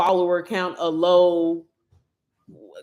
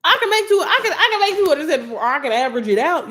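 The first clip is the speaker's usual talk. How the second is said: excited boast; 7 semitones higher than usual. Retold or imitated. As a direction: burst out with a laughing brag. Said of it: "I was like".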